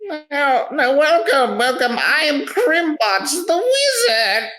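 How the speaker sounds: very high-pitched theatrical wizard; eccentric wizard merchant. Acted. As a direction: play a welcoming, showy wizard in a high voice. Plays wizard merchant character.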